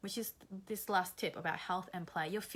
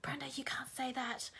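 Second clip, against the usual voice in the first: whispering; 6 semitones higher, 3 dB quieter than usual. Retold or imitated. Friends scolding her, quoted.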